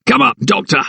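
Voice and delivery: a gruff keeper barking a command; barked order. A narrator's reading. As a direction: snap it out as an order. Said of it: "barked Wisdom".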